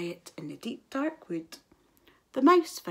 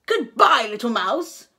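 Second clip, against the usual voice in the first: Owl's farewell; 2 semitones higher, 9 dB louder than usual. A narrator's reading.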